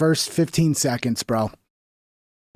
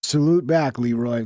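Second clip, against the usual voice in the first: ordinary delivery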